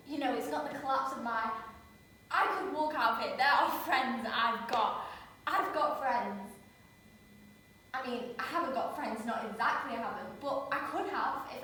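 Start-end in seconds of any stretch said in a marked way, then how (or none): 2.3-6.5 louder and slow, each word pressed; defiant insisting. Acted. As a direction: dig your heels in. Leah insisting to Philip.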